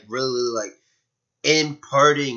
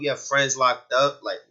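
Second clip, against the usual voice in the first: ordinary talk, nothing marked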